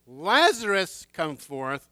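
slow, loud summons; commanding call. Acted. Jesus calling Lazarus out, slow loud command. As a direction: call out the name, drawn slow. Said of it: "Jesus hadn't specifically said".

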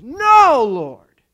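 loud, high "no" dropping away; emphatic protest. Acted. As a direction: protest as a loyal follower. Acting Peter's protest to Jesus.

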